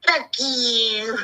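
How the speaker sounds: a light mimic of a rude streamer; imitating her. Mocking a streamer who cursed at her, lightly voiced. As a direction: toss it off the way she does, lightly voiced. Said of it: "I could do her perfectly when she goes".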